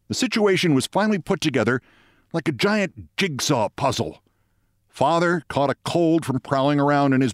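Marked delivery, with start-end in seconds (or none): none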